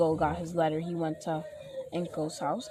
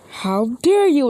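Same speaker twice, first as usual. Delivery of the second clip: high, loud mock outrage; indignant retort; 7 semitones higher, 11 dB louder than usual. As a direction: snap back loudly, hammed-up offence. Acted indignant retort in fight.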